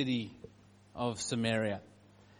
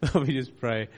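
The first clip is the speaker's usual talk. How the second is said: prayer; 4 semitones lower than usual. Acted. Pastor prays over family.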